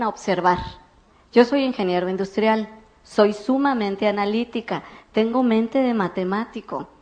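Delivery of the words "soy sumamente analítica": ordinary talk, nothing marked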